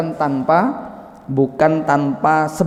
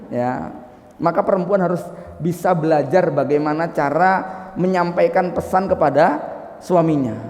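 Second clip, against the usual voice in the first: usual speaking voice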